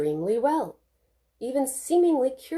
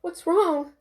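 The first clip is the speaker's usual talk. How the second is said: higher, anxious; panicked question; 10 semitones higher, 4 dB louder than usual. A narrator's reading. Mother asking the doctor in a panic. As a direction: ask in sudden worry. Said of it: "asked Lisa, panicking".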